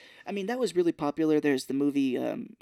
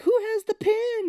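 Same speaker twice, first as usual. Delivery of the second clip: much higher cartoon squeak; squeaky rock people; 5 dB louder than usual. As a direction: squeak it like tiny frantic creatures. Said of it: "They were like".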